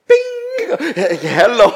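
high, loud mock hello; comic pop-up greeting. Man wishing to slip away, comic high greeting. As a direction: ring out a bright ping, then greet. Imagined slipping away, popping up.

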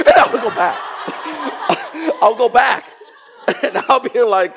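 high, loud laughing speech. Real, not acted. Preacher recalling going back for receipts, laughing. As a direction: tell it through your own laughter. Laughing at his own story.

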